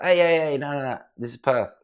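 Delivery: high, loud "hey hey hey" rushing in to stop him; excited protest. Retold or imitated. Jackie stopping his bouncer, high and loud excited protest. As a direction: jump in high and loud to call off the guard.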